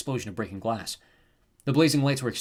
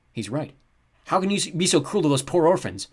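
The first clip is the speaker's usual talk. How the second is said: indignant guest; 6 semitones higher, 7 dB louder than usual. A narrator's reading. Guests muttering at guard.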